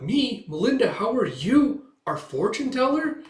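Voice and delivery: lightly voiced young woman, startled; surprised Yor. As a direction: caught out, pitch up. Manga reading, Yor voiced.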